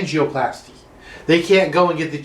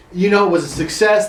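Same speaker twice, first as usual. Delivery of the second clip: ordinary talk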